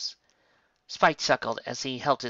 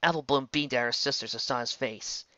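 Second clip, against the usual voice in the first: usual speaking voice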